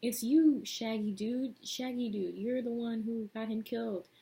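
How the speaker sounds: group blaming Shaggy dude, mock angry; mock accusation. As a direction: point the finger in a hammed-up voice. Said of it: "And they're all like".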